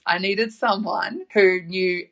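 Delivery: ordinary talk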